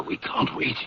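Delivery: hushed and urgent, mostly breath; urgent whisper. Acted. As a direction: whisper so the fugitive won't hear. Closing in on the fugitive.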